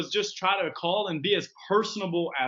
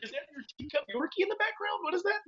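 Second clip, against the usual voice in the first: mock bubbly caller; 11 semitones higher, 3 dB quieter, faster than usual. Acting out a cold call.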